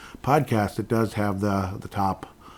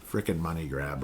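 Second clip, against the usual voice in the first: normal talking voice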